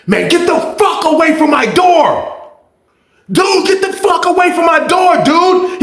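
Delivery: high, shouted get-away; angry yell. Retold or imitated. Mike at his door chasing the dude off, high angry yell. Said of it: "He was like".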